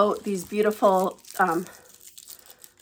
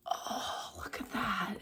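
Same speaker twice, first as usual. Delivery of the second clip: choked, tearful whisper; teary whisper; 15 dB quieter, slower than usual. Real, not acted. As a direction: whisper it through welling tears. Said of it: "I'm gonna cry again".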